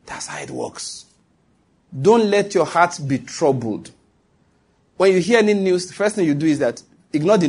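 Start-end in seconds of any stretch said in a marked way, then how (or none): none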